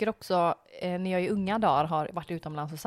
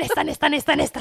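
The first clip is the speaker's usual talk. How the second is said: mock eager calling; 7 semitones higher, 9 dB louder, faster than usual. Mocks friend scaring kids in line.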